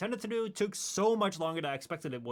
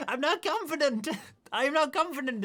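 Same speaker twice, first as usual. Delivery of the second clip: mock flustered protest; 10 semitones higher, 5 dB louder than usual. Retells protesting about doing accents.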